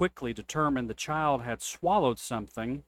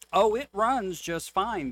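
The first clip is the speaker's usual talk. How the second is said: bright, higher smiling reassurance; with a smile; 7 semitones higher, 3 dB louder than usual. A narrator's reading. The seller answers the preacher's complaint, smiling. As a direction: smile through the words like a sly seller. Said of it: "the seller said with a smile".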